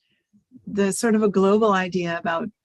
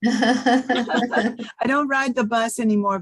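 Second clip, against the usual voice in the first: laughing; 3 semitones higher, much slower than usual. Real, not acted. Joking about taking the challenge.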